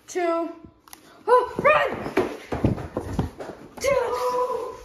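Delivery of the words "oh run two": high panicked yelling; excited shout. Real, not acted. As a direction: yell in a panic. Panic in game chase.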